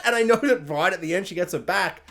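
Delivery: usual speaking voice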